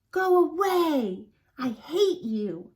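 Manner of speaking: loud, slow furious shout. Acted. On shouts at grandfather, loud and slow. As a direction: yell it at him, pushing away. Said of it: "An shouted".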